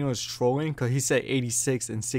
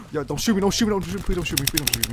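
higher, urgent repeated begging; anxious pleading; 4 semitones higher, faster than usual. Real, not acted. Player begging enemies not to shoot, anxious pleading. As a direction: plead in a rush, easing off slightly.

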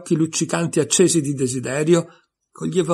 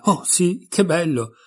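delighted; 5 semitones higher, slower than usual. A narrator's reading. Alice delighted.

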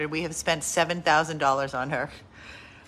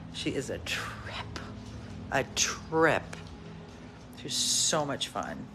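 whispered aside; 6 semitones lower, 7 dB quieter than usual. Real, not acted. Owner whispering to viewers about her funny dog. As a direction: whisper it confidentially.

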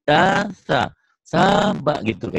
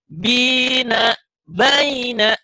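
bold correct reading; 11 semitones higher, much slower than usual. Shown as a demonstration. Teacher shows pupil the bold correct recitation. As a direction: ring it out with full confidence. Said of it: "Nggak boleh gitu".

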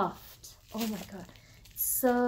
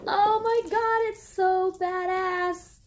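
higher, louder, slow sung gush; thrilled singsong; 10 semitones higher, 6 dB louder, slower than usual. Real, not acted. Vlogger singing out her delight at the strap. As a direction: sing out your delight.

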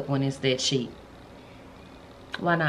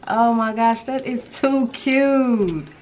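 high, loud, stretched-out delight; gush, dragging out the last words; 6 semitones higher, 5 dB louder, much slower than usual. Real, not acted. Thrilled by her gift, delighted gushing.

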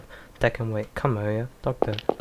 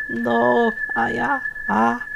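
much higher, slow, thin dying voice; fading farewell; 11 semitones higher, much slower than usual. Acted. Mother fades away while Aya calls after her, high and drawn out. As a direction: let the voice drift away as the body vanishes.

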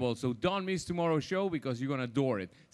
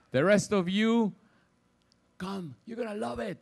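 loud, upbeat party announcement; upbeat cruise director; 2 semitones higher, 5 dB louder than usual. Real, not acted. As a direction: pump up the audience for the party.

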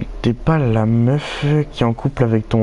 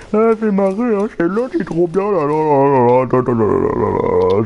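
much higher, gleeful rapid chatter; excited giggling; 5 semitones higher, 4 dB louder than usual. Real, not acted. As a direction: bubble over with glee. Excited laughing fit over meme. (excité, aigu et rieur)

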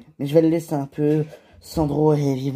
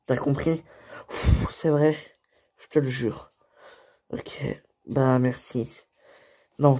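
ordinary delivery

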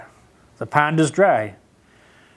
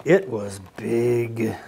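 slow and low; 5 semitones lower, 4 dB quieter, much slower than usual. A narrator's reading.

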